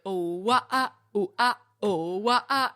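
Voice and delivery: mock wailing vocal runs; imitating flash mob singers. Co-host mocking the singers who start a flash mob video, wailing. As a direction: wail out silly singing noises. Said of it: "somebody going, like".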